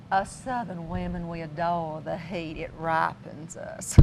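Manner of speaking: slow, lightly attempted Southern belle drawl; a soft Southern lady savoring the heat; Southern accent. Host imitating a Southern woman in a Southern accent. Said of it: "some woman with a wonderful Southern accent".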